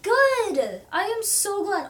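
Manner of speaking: high, loud happy burst; bright delighted welcome. Real, not acted. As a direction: cheer them on with a big high "Good!". Happy reply to a viewer.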